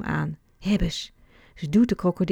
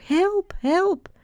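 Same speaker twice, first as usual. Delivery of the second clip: very high, louder repeated cry; calling for help; 13 semitones higher, 4 dB louder than usual. A narrator's reading. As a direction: cry out twice to be heard. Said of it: "En dan roept Snoek ook".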